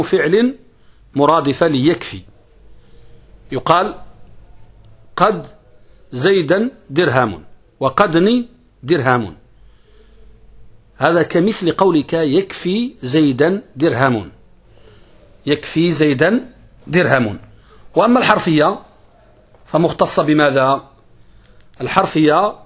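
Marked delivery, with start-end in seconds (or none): none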